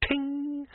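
high bright ting sound. A narrator's reading. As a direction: ring it out like a bell. Sound of a glint.

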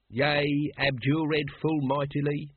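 slow ceremonial affirmation; intoned response. A narrator's reading. Lodge brother answering the ritual question, slowly intoned.

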